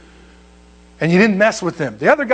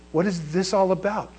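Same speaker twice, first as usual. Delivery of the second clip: softer, wondering to himself; puzzled inner thought; 8 dB quieter than usual. Retold or imitated. Recruit wondering silently before the sergeants, softly puzzled. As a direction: puzzle over it under your voice. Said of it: "I'm thinking".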